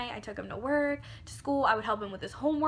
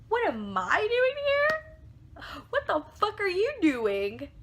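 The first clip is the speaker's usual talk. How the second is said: high, loud, drawn-out mock-incredulous shriek; outraged retort; 10 semitones higher, 5 dB louder, slower than usual. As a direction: throw his question back in shrill disbelief. Outraged echo of his question.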